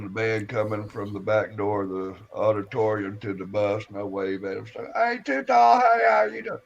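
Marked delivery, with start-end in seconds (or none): 5.0-6.6 high, louder friendly hail; retold call to a friend at a distance. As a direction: shout a greeting across the lot. Calling out to band member.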